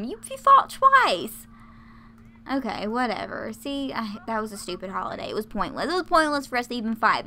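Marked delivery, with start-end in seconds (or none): 0.0-1.5 incredulous. Acted. Mock outrage at a Sim.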